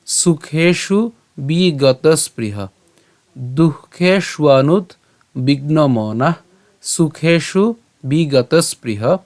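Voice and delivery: drawn-out Sanskrit phrases with pauses; slow model recitation. Shown as a demonstration. Teacher models the verse phrase by phrase, slow recitation. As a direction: stretch each phrase for learners to follow.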